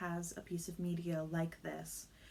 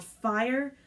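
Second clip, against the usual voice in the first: raised, louder yell held inside narration; mild narrated yell; 5 semitones higher, 7 dB louder than usual. A narrator's reading. Child narrator imagining crying fire in a room, mild yell. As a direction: call it out louder and higher, without full force.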